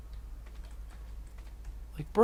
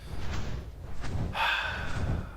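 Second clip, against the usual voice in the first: sigh; 12 dB quieter than usual. Real, not acted. Wasted a poop.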